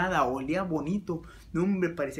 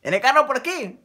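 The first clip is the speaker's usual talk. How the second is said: raised, higher lookout call; 6 semitones higher, 8 dB louder than usual. Acted. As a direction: call out to check for cars. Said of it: "Y entonces le digo".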